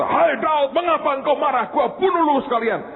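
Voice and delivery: hammed-up stern God voice; mock angry God. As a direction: play a God who snaps at David. Mock quote of God scolding.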